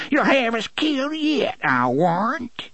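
very high, quavering complaint; cracked, quavering voice. A narrator's reading. Blind Mercy scolds Si in fear, cracked quavering voice. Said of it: "cried in a cracked, quavering voice".

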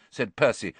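ordinary delivery, nothing marked

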